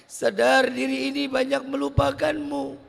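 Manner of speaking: high, loud, slow pleading prayer, half sung; weeping prayer. Acted. Preacher praying for forgiveness for the sinners, tearful pleading. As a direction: plead with God through tears.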